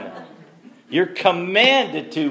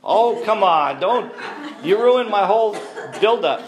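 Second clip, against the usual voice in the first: loud, higher-pitched playful protest; mock exasperation; 7 semitones higher, 5 dB louder than usual. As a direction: complain jokingly at the heckler for spoiling your moment. Mock protest at audience heckle.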